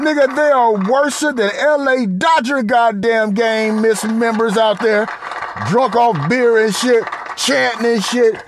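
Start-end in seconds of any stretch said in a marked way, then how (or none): none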